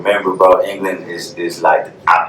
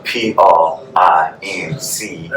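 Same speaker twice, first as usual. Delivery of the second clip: letter by letter, slow and careful; slow spelled-out letters; spelled out slowly; much slower than usual. Shown as a demonstration. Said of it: "For them people that ... got trouble spelling".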